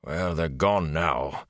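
a lightly voiced tired village smith, slow; weary blacksmith. Blacksmith consoling Rand after the raid, lightly voiced and weary.

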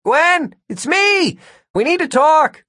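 high, drawn-out yell. A narrator's reading. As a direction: call out urgently to someone behind a closed door. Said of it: "then yelled".

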